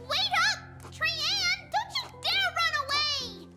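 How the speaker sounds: high-pitched indignant chase cry; excited, high call to stop; calling after someone. Acted. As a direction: yell after the runaway in a high voice. Calls after fleeing Trian.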